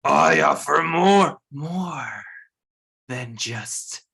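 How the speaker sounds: loud, slow eerie voice; creepy voice. Acted. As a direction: boom the offer ominously. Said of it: "in their creepy voice".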